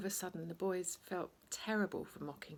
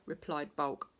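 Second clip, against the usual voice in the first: plain reading voice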